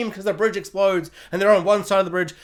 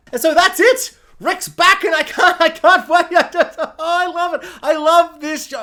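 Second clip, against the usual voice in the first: much higher, louder fangirl rave; excited rave; 10 semitones higher, 7 dB louder than usual. Real, not acted. Vlogger raves about Rick's return, much higher and louder.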